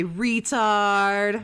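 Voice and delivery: loud, drawn-out jeer; mocking taunt. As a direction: stretch it into a loud, held mock call. Taunts streamer with drawn-out insult.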